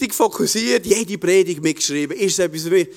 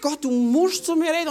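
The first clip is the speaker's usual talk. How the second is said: urgent plea; 10 semitones higher than usual. Retold or imitated. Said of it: "ich habe gedacht".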